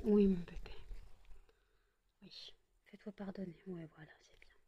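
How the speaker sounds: soft unvoiced coo to the cat; whispering to pet. Real, not acted. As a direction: hush and soothe the pet. Soothing cat softly. (chuchotement tendre à l'animal)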